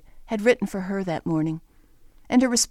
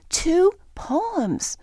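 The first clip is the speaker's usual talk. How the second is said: excited; 9 semitones higher than usual. Retold or imitated. Child's excited reply quoted.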